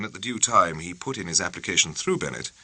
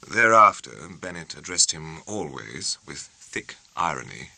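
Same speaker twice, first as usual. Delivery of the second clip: ordinary delivery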